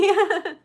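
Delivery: a quick high burst of laughter. Real, not acted. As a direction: laugh out, amused. Live sale, reacting to bid.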